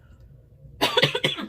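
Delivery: sudden hard choking cough. Real, not acted. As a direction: cough on food gone down wrong. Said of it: "I went down a wrong hole".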